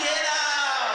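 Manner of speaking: high, loud laughing cheer; cheering shout. Retold or imitated. Front row fans rallying against the drunk, loud high shout. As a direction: egg them on with a whoop. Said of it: "they were like".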